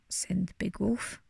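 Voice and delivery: usual speaking voice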